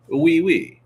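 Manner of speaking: hammed-up French oui oui; playful fake French; mock French. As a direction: toss off a cartoon French oui. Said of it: "That's my French".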